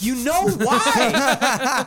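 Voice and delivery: high, loud, drawn-out retort; exasperated outburst. Retold or imitated. Retelling his confrontation with the roommate, exasperated outburst.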